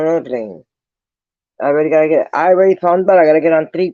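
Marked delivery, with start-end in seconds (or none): none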